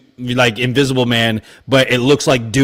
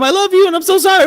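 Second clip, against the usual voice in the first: high, fast hammed-up confession; mock tearful confession; much faster than usual. Gale spilling her guts to Dewey, high and rushed. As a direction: blurt it out like a soap heroine.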